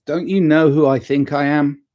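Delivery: jokey, self-mocking diva line; a hint of superstar airs, laughing at yourself. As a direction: play the pampered star, tongue in cheek.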